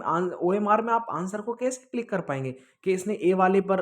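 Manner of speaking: usual speaking voice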